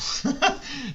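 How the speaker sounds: high laugh at his own joke; real short laugh. Real, not acted. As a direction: chuckle at yourself. Joking about being poor.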